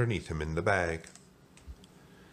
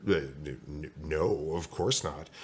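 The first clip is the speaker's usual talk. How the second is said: low, soft stammer; low, halting backpedal; flustered denial; 4 semitones lower, 4 dB quieter, slower than usual. Acted. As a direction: stammer sheepishly, pitched down. Flustered man denies it.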